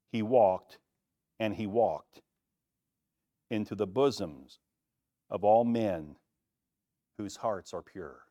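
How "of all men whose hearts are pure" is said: usual speaking voice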